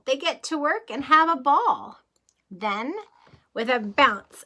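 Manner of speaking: lively rhythmic storybook read; reading to children. Acted. Teacher reading a train book to kids, lively storybook voice. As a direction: read the rhyme like a bedtime adventure.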